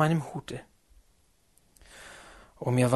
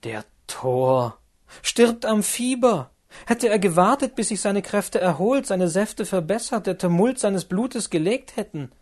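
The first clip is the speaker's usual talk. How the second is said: mock know-it-all; 6 semitones higher, 3 dB louder than usual. Werther mimics a smug moralizer, hammed-up and high. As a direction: parody a smug physician. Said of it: "als wenn einer sagt".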